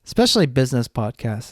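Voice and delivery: normal talking voice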